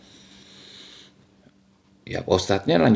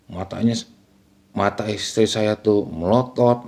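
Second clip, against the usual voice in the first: ordinary delivery, nothing marked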